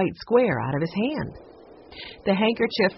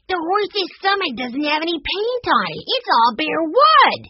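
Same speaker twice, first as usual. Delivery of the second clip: much higher, louder, slowing at the end; excited voice; 12 semitones higher, 6 dB louder than usual. A narrator's reading. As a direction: blurt out the discovery, thrilled. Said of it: "said in an excited voice".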